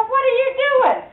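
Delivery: put-on kids' voices. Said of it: "all the other kids came around and go".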